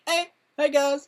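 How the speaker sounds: high, bright put-on "hey guys" voice; mock streamer greeting. Impersonating a streamer greeting his viewers, high put-on voice. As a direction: imitate a hyped streamer saying hi.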